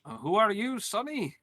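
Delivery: put-on high, lilting old-timer question; puzzled old man not recognizing someone; senile grandpa. Playing a supposedly senile grandfather.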